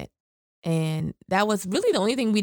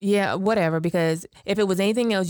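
normal talking voice

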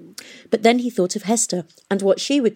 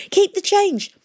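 high, loud parting shout; 14 semitones higher, 4 dB louder than usual. A narrator's reading. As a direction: yell it over your shoulder as you run.

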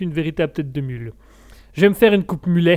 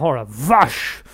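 drawn-out exclamation; 4 semitones lower, much slower than usual. Pretend. Mock exclamation, slowed.

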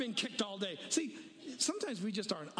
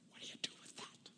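a much softer, breathy whisper; hushed wondering whisper; whispered question; 13 dB quieter than usual. Real, not acted. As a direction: whisper the puzzle to the room. Ponders a hard text.

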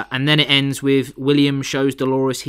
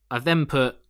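usual speaking voice

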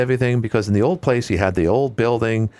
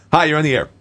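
ordinary talk, nothing marked

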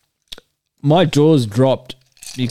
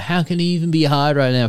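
lighter retold heckle; 5 semitones higher, faster than usual. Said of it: "yelling out".

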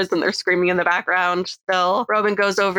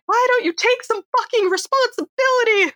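mock shrill yell. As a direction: screech it like a squabbling sibling. Sydney voiced yelling back.

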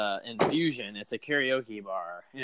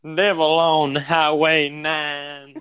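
[name] impression; 2 semitones higher, 7 dB louder, much slower than usual. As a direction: croon it like [name]. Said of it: "Go ahead and do your [name]".

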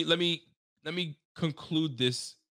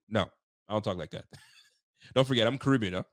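normal talking voice

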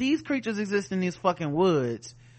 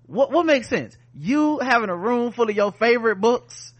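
higher, louder indignant challenge; intense; 7 semitones higher, 5 dB louder than usual. Retold or imitated. Recapper acting out Tick confronting the others, intense. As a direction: throw it back as a heated rhetorical question. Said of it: "he was just so intense when he was just like".

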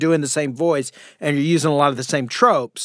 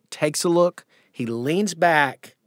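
usual speaking voice

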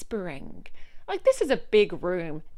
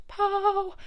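excited burst; 12 semitones higher, 4 dB louder than usual. Real, not acted. Story goes out.

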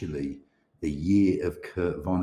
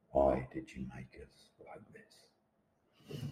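even slower and much softer, fading into a hush; fading lament; 5 semitones lower, 10 dB quieter, much slower than usual. Acted. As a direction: let the plea die away almost under the breath. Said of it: "accusing finger at the sky".

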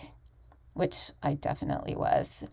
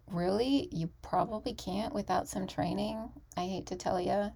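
ordinary talk

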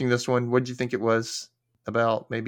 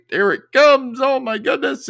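high, loud mock build to a reveal; hammed-up excitement; 12 semitones higher, 6 dB louder than usual. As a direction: gush as if the big moment arrives. Hammed-up reveal as search loads.

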